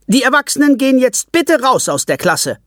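teacher orders parents out; firm teacher. Acted. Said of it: "sagt Frau Schrock".

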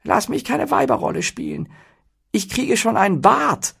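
very high, pleading protest; appalled falsetto whine; horrified. A narrator's reading. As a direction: object in squeaky comic dismay. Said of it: "rief Flaut entsetzt".

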